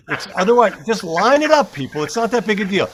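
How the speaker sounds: hammed-up exasperation; mock exasperated rant. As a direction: rant as if fed up with the experts. Mock rant about hurricane names.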